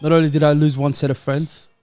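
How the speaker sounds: normal talking voice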